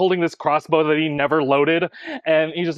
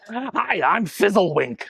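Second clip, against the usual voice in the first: lightly voiced goblin; 5 semitones higher, slower than usual. GM voices shy rat-man.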